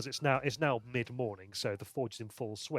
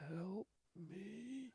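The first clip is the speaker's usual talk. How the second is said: faint, higher plea from far away; weak distant voice; 5 semitones higher, 18 dB quieter, much slower than usual. Acted. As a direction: beg weakly, as if from a distance. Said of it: "this voice sounds like it's very weak and very far away".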